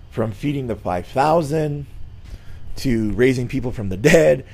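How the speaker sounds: ordinary talk, nothing marked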